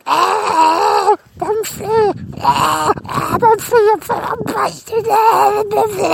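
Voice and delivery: very high, loud creature shrieks; screaming sock. Acted. Evil sock sucked into the astral trap, screaming shrilly. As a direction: shriek wildly as the trapped creature. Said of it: "The sock screamed, screamed".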